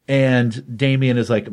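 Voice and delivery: ordinary talk, nothing marked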